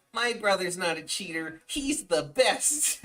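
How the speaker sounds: chirpy loyal little brother defending him. Retold or imitated. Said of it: "He's like".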